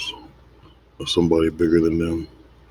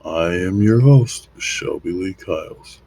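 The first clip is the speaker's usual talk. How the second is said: show host intro; 3 semitones higher, 4 dB louder than usual. Acted. Host welcomes listeners to the monologue, theatrical intro. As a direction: stretch the words like a show opener.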